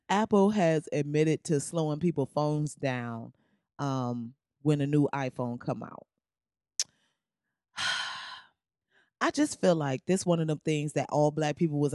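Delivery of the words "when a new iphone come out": ordinary talk, nothing marked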